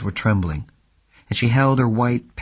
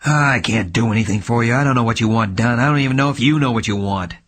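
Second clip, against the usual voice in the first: louder, fast savage outburst; detective losing patience with his client; savagely; 2 semitones higher, 5 dB louder than usual. Acted. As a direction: lash out in frustration. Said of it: "he said savagely".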